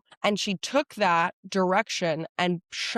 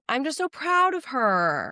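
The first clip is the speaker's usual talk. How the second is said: proud gush; 7 semitones higher than usual. Real, not acted. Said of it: "because I'm so proud of her".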